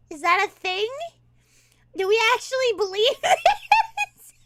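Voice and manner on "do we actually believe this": loud, high, dissolving into laughter; incredulous laughing question; laughing disbelief. Real, not acted. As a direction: ask it while cracking up. Cracking up at the clip.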